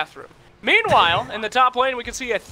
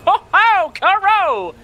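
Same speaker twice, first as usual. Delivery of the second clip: higher and louder live hype call; excited shout; 9 semitones higher, 7 dB louder, much slower than usual. Real, not acted. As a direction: yell the kill as it happens. First blood in the match.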